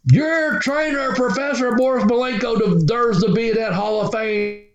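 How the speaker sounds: slow, drawn-out promo voice, pitched high; wrestling promo. Retold or imitated. Veteran wrestler honouring the trainer in the locker room, imitated promo delivery. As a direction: imitate a wrestler cutting a promo, stretching every name. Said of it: "You ain't gotta cut a promo".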